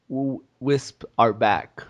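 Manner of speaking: low, slow put-on voice; slow, low mock declaration; imagined developer team. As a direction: draw it out low as a mock announcement. Voicing a smug game team.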